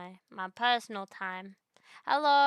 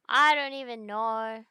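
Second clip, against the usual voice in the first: louder and slower, stretching it out in disbelief; exasperated louder repeat; 9 dB louder than usual. Real, not acted. Streamer to chat, fed up with bans, exasperated loud slow repeat. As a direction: lean into it, exasperated, drawn out.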